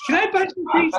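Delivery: put-on high squeaky kid begging for pizza; little kid voice. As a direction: ask like an eager small boy.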